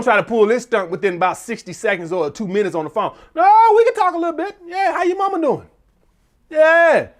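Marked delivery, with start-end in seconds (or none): 3.3-7.1 overeager man imitation. Man stalling her on the phone, high and overeager. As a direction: sing out eager small talk.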